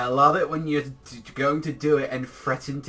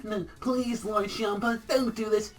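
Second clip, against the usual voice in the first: much higher, begging whine; pleading Kabe; 8 semitones higher than usual. Retold or imitated. Kabe begging Lord Champa, high mock pleading. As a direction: plead with the god in a mock whimper. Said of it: "when Kabe said".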